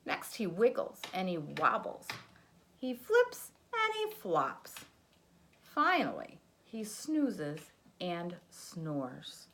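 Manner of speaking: put-on lively read-aloud for a child; sing-song picture book narration; storybook reading. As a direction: read it like bedtime story time.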